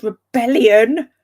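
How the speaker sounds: drawn-out excited lift; gleeful slow rebellion. Real, not acted. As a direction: savour the word with delight. Excitedly listing favourite tropes.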